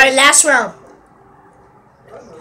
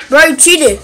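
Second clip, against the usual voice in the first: laughing, louder accusation; 8 dB louder than usual. Real, not acted.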